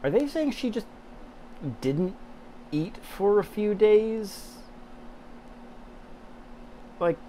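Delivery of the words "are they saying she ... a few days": high, slow disbelieving question; can't believe what she just read; incredulous. Real, not acted. Incredulous aside on the story.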